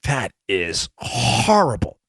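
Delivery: slow, drawn-out mock horror; appalled liberal. Imagined liberal listener reacting to the bill, mock appalled. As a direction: act out an appalled liberal, stretching it. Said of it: "every liberal listening would be like".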